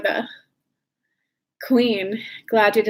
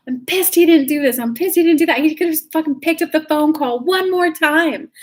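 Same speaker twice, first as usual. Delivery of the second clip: higher-pitched mock venting; demonstrated temper tantrum; 5 semitones higher than usual. Shown as a demonstration. Coach showing how to get the energy out, mock tantrum. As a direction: act out a pissed-off rant, pitch up. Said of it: "throw a thirty-second temper tantrum".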